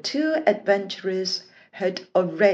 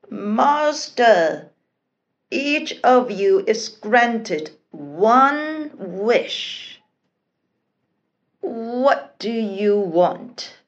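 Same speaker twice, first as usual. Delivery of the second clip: slow, drowsy genie voice; sleepy listless genie; 3 semitones higher, 4 dB louder, much slower than usual. A narrator's reading. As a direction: drag the words out half-asleep. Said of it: "rubbing his eyes listlessly".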